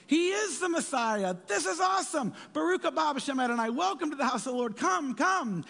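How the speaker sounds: high, loud joyful hailing of the Messiah; excited crowd cheer. Acted. Crowd in the temple greeting Jesus, high excited cheering. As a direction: cheer him in like a thrilled crowd.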